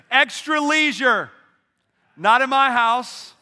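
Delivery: slow shout of the preacher leading his flock; call and response. Shown as a demonstration. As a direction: lead the room in a loud call. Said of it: "So let's do this".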